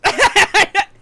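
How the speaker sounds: high, loud burst of laughter. Real, not acted. Bursts out laughing after argument.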